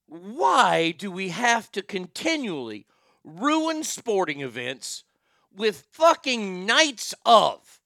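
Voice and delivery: slow, drawn-out exasperation; exasperated rant. Real, not acted. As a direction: stretch each word in fed-up disbelief. Exasperated rant about theme nights.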